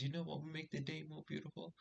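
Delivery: high, sly teasing lead-in; cheeky setup. Retold or imitated. Delivering his dirty joke to the girls, sly and high.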